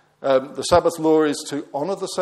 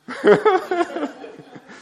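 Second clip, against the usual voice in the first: short high laugh; a quick amused burst of laughter; laughing at his own joke; 3 dB louder than usual. Real, not acted. Joke about wooden leg.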